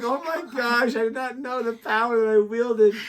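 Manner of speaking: high-pitched mock astonishment; mock amazement. Speaker retelling reaction to power wielded, mock amazed. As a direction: retell being stunned, high and loud.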